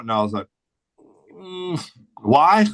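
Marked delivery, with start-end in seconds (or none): 1.3-2.7 pitched-up, incredulous retort; mock reluctant whine. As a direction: play it like you can't believe the request. Retold reluctant reply to boss.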